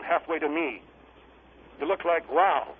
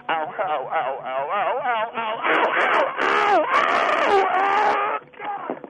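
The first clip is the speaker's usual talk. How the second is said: screaming in pain; 5 semitones higher, 5 dB louder, much slower than usual. Acted. Guest walking across hot steel, screaming in pain. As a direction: yelp faster and higher into full screams. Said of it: "You were screaming".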